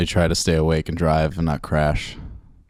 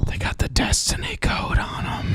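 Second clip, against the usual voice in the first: awed whisper; 4 dB quieter than usual. Acted. Shopper whispering in awe about the Red Bulls.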